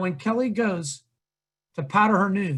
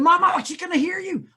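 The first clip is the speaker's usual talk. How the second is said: alarmed protest; 8 semitones higher than usual. Retold or imitated. Son alarmed mother is overheard.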